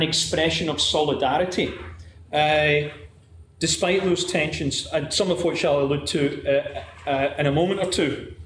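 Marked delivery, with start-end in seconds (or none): none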